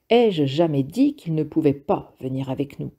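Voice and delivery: usual speaking voice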